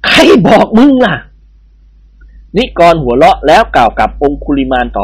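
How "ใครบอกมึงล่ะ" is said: put-on a gruff old lord bares his teeth, higher and edgy; irritated old official retorting; snarling old nobleman. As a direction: snap it out at the babu with a laugh. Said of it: "ท่านเจ้าคุณแยกเขี้ยว".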